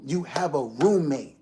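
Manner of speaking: ordinary talk, nothing marked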